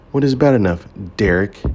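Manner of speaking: Derek pressed hard, sharp and loud; emphasis on Derek. Acted. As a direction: lean hard on the name Derek. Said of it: "with emphasis on his name".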